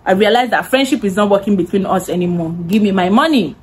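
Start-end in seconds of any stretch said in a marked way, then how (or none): none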